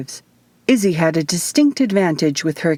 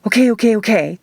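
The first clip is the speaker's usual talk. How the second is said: eager, bouncing okays, the first one higher and louder; can't wait to try it; girl jumping with excitement, quick eager okays; excited; 5 semitones higher, 3 dB louder, faster than usual. A narrator's reading. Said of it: "Izzy jumped in excitement".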